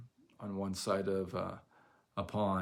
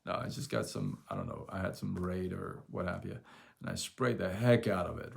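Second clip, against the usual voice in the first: ordinary talk, nothing marked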